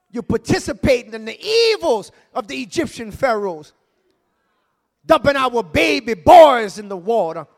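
shouted sermon over music; full-force preaching. Acted. Pastor preaches to the church, shouting over the music. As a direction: call it down in Jesus' name.

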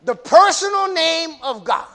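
emphatic pulpit teaching; fervent preaching. Real, not acted. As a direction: drive the point home to a congregation. Preaching at full force.

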